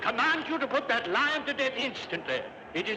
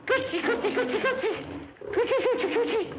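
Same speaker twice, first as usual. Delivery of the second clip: high cooing coochie-coochie; a nervous emperor cooing high at the lion; baby talk; 11 semitones higher than usual. Acted. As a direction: coo at the beast like a baby. Emperor coos to tame the lion.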